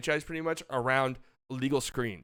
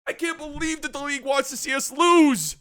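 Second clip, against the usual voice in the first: high, mocking fan voice; lightly hammed-up fan complaint; mocking whiny fan; 13 semitones higher, 3 dB louder, slower than usual. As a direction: mimic a whining Warriors fan. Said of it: "Warriors fans are like".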